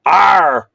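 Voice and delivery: loud throaty "arr" battle noise; sudden loud mock killing yell; killing sound cry. Shown as a demonstration. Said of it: "suggesting a killing sound".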